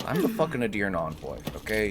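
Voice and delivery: usual speaking voice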